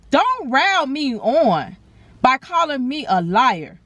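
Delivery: slow, deliberate warning to the staff; slow, pointed telling-off; slow scolding. Real, not acted. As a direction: spell it out slowly, daring them.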